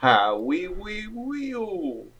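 a soft French oui trailing off; mock French accent. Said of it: "Jamaican French accent".

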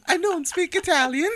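very high, loud falsetto; squeaky mock-Italian reading; silly cartoon voice close to cracking up; high-pitched. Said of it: "Why are you high-pitched?".

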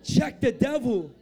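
slow, emphatic preacher's command; forceful command. Real, not acted. Preacher rousing congregation to tell neighbors, forceful. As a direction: repeat it with full preaching force.

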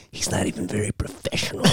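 soft mock moderator voice; mock YouTube moderator. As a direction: quietly play the prissy moderator. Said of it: "He's like".

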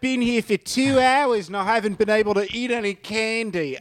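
high, whiny mock complaint; mock Rebel Wilson whine. Friend mocking Rebel stranded in the outback, high whiny voice. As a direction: lament like a stranded celebrity. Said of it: "her bear".

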